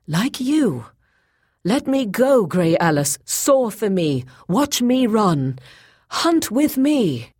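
high, drawn-out, yearning entreaty; passionate plea. A narrator's reading. Bound Boyce begging Grey Alis to hunt with him, passionate plea. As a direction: implore her fervently. Captive's final plea to be freed.

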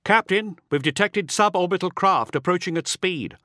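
high-pitched urgent alarm; urgent soldier's report. A narrator's reading. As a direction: alert your captain to danger. Urgent report over comm bead.

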